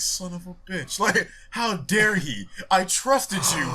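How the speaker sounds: hammed-up heartbreak; mock hurt. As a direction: draw it out like a betrayed fan. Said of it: "sounded like he was really hurt".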